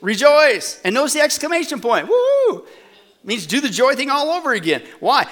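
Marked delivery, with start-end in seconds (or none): 0.0-0.8 louder, ringing "Rejoice"; emphatic repeat. Shown as a demonstration. Preacher repeats the command for the congregation, louder "Rejoice". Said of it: "I'll say it again". 2.1-2.7 high, loud celebratory woo; joyful whoop. Acted. Preacher acts out the exclamation point, loud joyful whoop.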